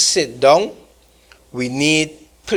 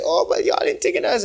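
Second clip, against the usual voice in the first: much higher, quick mocking protest; mimicking a grievance, pitched way up; 13 semitones higher, faster than usual. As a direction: parody a group crying left out. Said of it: "Amerindian folks are gonna stand up and say".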